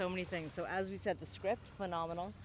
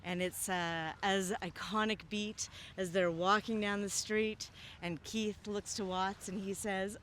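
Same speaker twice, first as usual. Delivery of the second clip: normal talking voice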